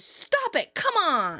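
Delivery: high, exasperated retold protest; mock-annoyed plea. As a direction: beg them to quit it. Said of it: "And Rachel says".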